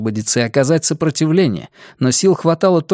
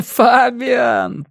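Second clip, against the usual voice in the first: mocking laugh; 11 semitones higher than usual. A narrator's reading. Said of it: "со смехом".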